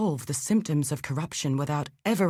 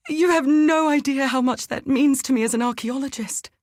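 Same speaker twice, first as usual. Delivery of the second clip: higher and louder, thrilled; excited; 8 semitones higher, 4 dB louder than usual. Acted. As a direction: gush with scholarly excitement. Excited reaction to discovery.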